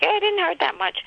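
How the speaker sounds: high, breezy no-big-deal voice; friend shrugging it off. Retold or imitated. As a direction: toss it off like it's nothing. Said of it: "she was like".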